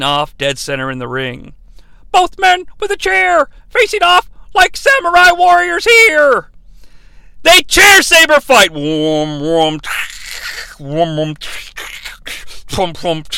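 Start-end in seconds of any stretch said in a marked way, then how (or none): 2.1-8.7 high, loud play-by-play hype; hyped announcer shout. Acted. Recapper selling a chair duel to listeners, hyped shout. 8.7-13.2 low voiced saber whooms; lightsaber hum imitation. Recapper mimicking a chair saber fight, lightsaber noises.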